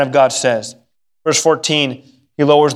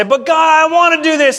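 high, whiny complaint to God; hardened protest; 9 semitones higher than usual. Acted. Believer resisting God's correction, hardened whining protest. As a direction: argue back like a thwarted child. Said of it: "we get hard and say".

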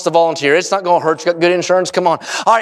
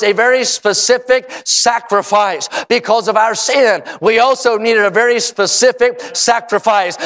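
emphatic, driving sermon cadence; impassioned preaching; 4 semitones higher than usual. Real, not acted. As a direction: preach the gospel with conviction. Preaches at length with force.

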